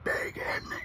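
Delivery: breathy, unvoiced aside; hushed emphasis. Comic tells crowd how big the line was, hushed. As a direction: drop to a soft, awed whisper. Repeats size of line for effect.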